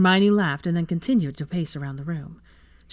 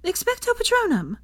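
much higher shouted spell; shouting. A narrator's reading. Hermione casting her Patronus, high shout.

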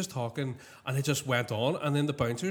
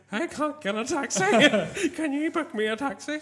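mock crying plea; 9 semitones higher, slower than usual. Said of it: "ring him crying, going".

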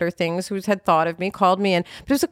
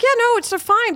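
breezy dismissal; 13 semitones higher than usual. Retold or imitated. Brushing off someone's apology.

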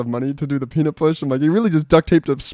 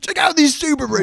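Australian car salesman; 11 semitones higher than usual. Retold or imitated. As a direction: pitch the Subaru like a pushy Aussie car dealer. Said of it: "like a guy trying to sell used cars".

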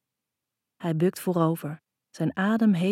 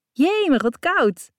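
sudden high yelp, much louder; crying out; 9 semitones higher, 7 dB louder, slower than usual. A narrator's reading. She cries out as his wet shirt touches her, high startled yelp. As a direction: shriek at the cold shock. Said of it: "roep ik".

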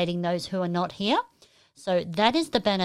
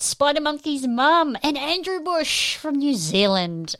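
high, louder roll-call; excited shout-out; 6 semitones higher, 5 dB louder than usual. Real, not acted. Host welcomes viewers from New Zealand, excited shout-out. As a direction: announce the names high and big.

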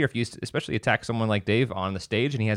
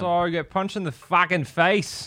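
punchy, raised Australian accent impression; 6 semitones higher than usual. Host mimics a comedian who got punched on stage, Australian accent. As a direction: do a cheeky Australian comic's accent. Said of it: "when I need to practice an Australian accent".

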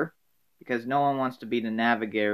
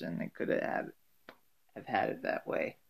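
trailing whisper; 6 semitones lower, 13 dB quieter than usual. Real, not acted.

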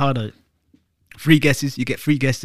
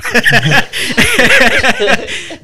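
a loud, high howl of laughter; 12 semitones higher, 7 dB louder than usual. Real, not acted. Laughing hard at his friends' joke, loud high laugh. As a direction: burst out in a big high laugh. Friends joking about dead guys.

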